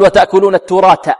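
التراث said turat, final th hardened to t; both letters as ta. Shown as a demonstration. Teacher imitates students' mistake, t for final th in التراث. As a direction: end التراث on t instead of th. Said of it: "والبعض يقول".

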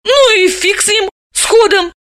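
high, offhand brush-off; careless dismissal. Acted. Dalila carelessly waves off Vanik. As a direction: wave it away. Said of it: "небрежно отмахнулась".

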